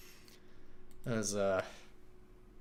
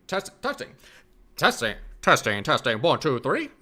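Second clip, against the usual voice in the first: put-on loud, booming old radio announcer; old-timey radio voice; 6 semitones higher, 9 dB louder than usual. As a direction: announce a mic check like 1930s radio. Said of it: "let me get a proper radio voice".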